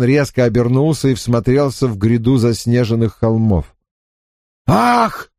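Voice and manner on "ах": sharp cry as if struck; pained cry. A narrator's reading. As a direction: gasp as if hit. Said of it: "вскрикнул он, словно его ударили".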